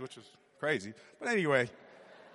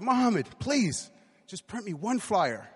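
drawn-out, higher-pitched mock begging; pleading; 6 semitones higher, slower than usual. Boy asking a friend to print one flyer, pleading. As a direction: beg slowly, stretching out the plea.

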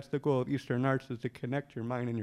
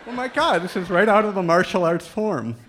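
excited realization; 5 semitones higher, 8 dB louder than usual. Retold or imitated. Sudden realization about martial arts.

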